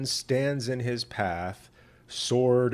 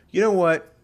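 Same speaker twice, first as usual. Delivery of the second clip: God's voice; 8 semitones higher, 9 dB louder than usual. Acted. God answers Balaam in dream.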